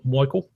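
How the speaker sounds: "Michael" with a broad "oy" vowel, like "Moykel"; imitated accent. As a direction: say "Michael" as "Moy-kul". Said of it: "I love the way he says Michael".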